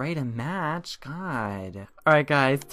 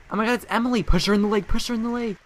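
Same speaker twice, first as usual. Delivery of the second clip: excited; 9 semitones higher, 4 dB louder, faster than usual. Acted. Excited call to push rival.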